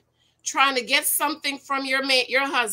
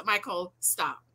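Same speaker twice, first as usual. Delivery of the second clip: calm low voice; 5 semitones lower, 7 dB quieter, slower than usual. Shown as a demonstration. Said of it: "this is him when I'm t".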